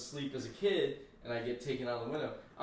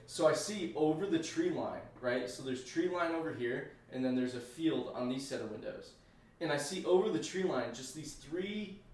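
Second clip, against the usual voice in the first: normal talking voice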